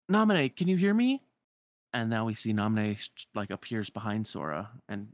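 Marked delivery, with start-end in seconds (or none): 0.1-1.2 high, loud searching call. Acted. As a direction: call to someone you cannot see. Sora calling for missing Namine.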